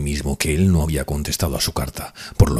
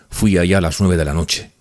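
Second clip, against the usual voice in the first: ordinary delivery, nothing marked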